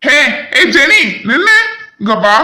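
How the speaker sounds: loud, high call to stop her. Acted. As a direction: shout after her as she slips away. Calling after fleeing Jeni.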